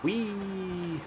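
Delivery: high squealing whee down the hill; gleeful whee. Real, not acted. Gamer squealing as the sled takes off, high and gleeful. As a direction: let out a delighted high whee. Sledding downhill, gleeful cry.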